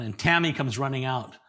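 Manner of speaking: normal talking voice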